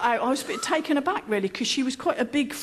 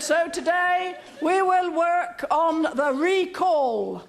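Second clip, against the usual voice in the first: higher, very slow, each word laid down; slow trainer pronouncement; 6 semitones higher, much slower than usual. Retold or imitated. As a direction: pronounce the lesson like a stern instructor. Trainer sets the lesson.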